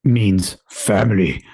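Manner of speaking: low, slow gravelly movie voice; deep action-hero rumble, slow; Vin Diesel voice. Acted. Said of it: "gravelly voice".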